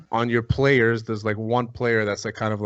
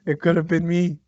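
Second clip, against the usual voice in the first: imitating an upset fan, higher pitched; 6 semitones higher than usual. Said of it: "He was so upset".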